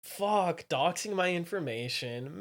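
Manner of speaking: ordinary talk, nothing marked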